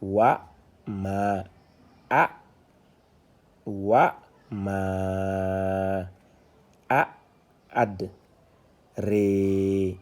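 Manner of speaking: slow model reading, pausing after each syllable; detached reading. Shown as a demonstration. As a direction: separate syllables, long vowels held steady. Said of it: "on est en détaché".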